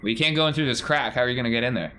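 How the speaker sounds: usual speaking voice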